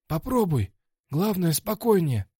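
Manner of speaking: high-voiced coach. Coach advises his fighter between rounds, high-voiced coaching. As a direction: give fight advice in a high-pitched coach voice.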